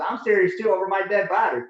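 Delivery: loud, high, hammering each word; mom's furious refusal. Retold or imitated. Mom forbidding her son to enlist, loud angry ultimatum. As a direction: dig in and refuse.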